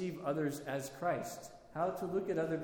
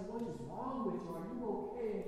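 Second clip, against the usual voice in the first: higher, tender pity; tender comfort; 6 semitones higher than usual. Retold or imitated. Saint Macarius pitying the beaten weeping priest, tender and higher. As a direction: coo over him like a mother over a hurt child.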